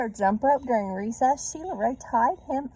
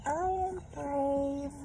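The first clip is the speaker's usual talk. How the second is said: slow, higher, softer unsure voice; trying to convince herself; 6 semitones higher, 4 dB quieter, much slower than usual. A narrator's reading. Lost Sheila Ray reassuring herself, slow and unsure. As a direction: talk yourself into courage, slowly. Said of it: "tried to convince herself".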